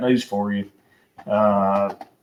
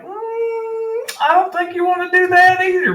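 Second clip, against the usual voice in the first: much higher, sing-song mock reply; answering the rival fan's taunt, in a much higher smug voice; smug comeback; 3 dB louder than usual. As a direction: toss back a cocky retort. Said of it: "And I was like".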